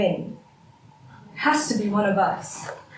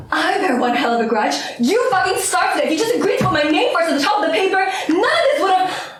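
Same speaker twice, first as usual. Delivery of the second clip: high, rapid, escalating outburst; heated argument, pitch climbing; furious retort; 7 semitones higher, 3 dB louder than usual. Acted. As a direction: throw the accusation back and build in anger. Quarrel, throws the accusation back.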